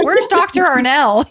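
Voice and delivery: calling out high and loud, teasing; like hunting for her in a crowd; playful calling out. Pretend. Host teasing the guest about her travels, playful call.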